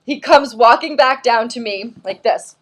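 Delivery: ordinary talk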